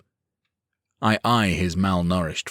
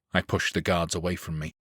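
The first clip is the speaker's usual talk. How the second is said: plain reading voice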